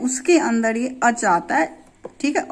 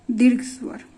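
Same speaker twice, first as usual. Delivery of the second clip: ordinary talk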